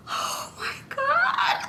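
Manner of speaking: very high, slow, soft crying squeal; mock tearful gasp. Past self reacting to his love confession, high tearful squeal. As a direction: overcome with happy tears, hammed up. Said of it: "this is me".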